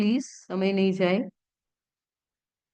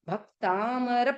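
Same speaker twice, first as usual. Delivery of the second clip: Bhaktamar said slowly, pressing hard on भ; stress on भ; 2 semitones higher, much slower than usual. Shown as a demonstration. Teacher shows the class where to press, slow stressed Bhaktamar. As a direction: lean on भ in Bhaktamar, syllable by syllable. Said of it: "आधा क आया तो भ पे pressure दो".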